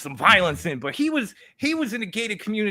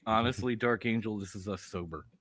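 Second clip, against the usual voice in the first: deadpan; 11 semitones lower, 10 dB quieter than usual. Real, not acted. Deadpan reply to clip.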